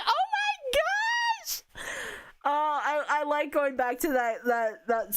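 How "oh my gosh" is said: very high, squeaky burst of delight; fangirl squeal. Retold or imitated. Fan recalling the seiyu flubbing his intro, squealing. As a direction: squeal like you can't take the cuteness.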